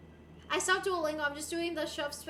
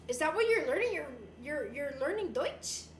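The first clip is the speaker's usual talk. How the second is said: ordinary talk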